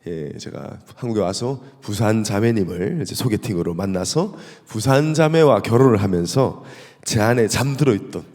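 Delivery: ordinary talk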